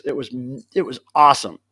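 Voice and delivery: ordinary delivery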